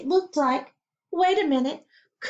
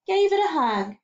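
usual speaking voice

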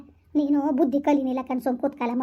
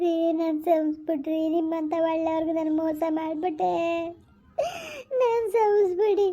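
weeping. Acted. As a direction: cry out your shame to your husband. Wife weeps before husband.

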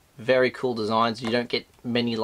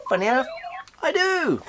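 much higher, chirpy; pleased surprise; 12 semitones higher than usual. Real, not acted. Host finding the gimmick, higher and chirpy. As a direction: pop it up brightly.

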